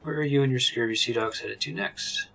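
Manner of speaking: ordinary delivery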